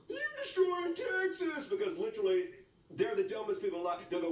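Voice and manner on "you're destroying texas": high, drawn-out hammed-up wail; melodramatic, high-pitched accusation; mock outrage. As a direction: wail it slowly in fake horror.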